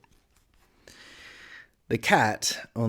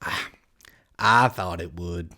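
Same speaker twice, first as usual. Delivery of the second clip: lightly voiced lower cat; knowing Cheshire Cat; 4 semitones lower than usual. A narrator's reading. Cat confirming its guess, lightly voiced and low.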